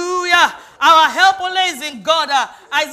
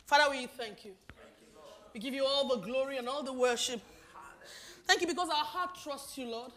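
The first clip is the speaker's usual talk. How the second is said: closing prayer; 9 dB quieter than usual. Acted. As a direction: pray quietly and devoutly to God.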